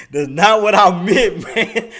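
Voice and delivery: loud, laughing denial, slowed; cracking up while insisting it was innocent; laughing protest. Real, not acted. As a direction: protest through real laughter. Embarrassed, laughing at viewers.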